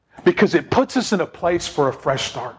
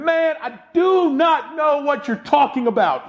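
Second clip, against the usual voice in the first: Peter snaps back that he does not know the man; emphatic denial; 8 semitones higher than usual. Acted. Peter's third denial shouted.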